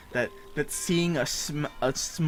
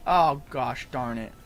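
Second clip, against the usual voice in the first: ordinary talk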